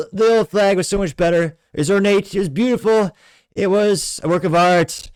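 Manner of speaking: high, loud, hammed-up politician impression; Trump. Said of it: "I'm sure if Trump were to".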